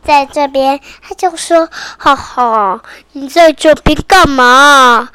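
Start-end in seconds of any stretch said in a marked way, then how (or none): 2.0-5.1 low, laughing teasing voice. Acted. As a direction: tease him like a sly stranger. Mysterious voice in the dream.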